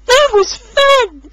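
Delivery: high, slow, pleased; smiling Rosie. A narrator's reading. Rosie pleased after the splash, high and slow. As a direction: savour the splash. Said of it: "she smiled".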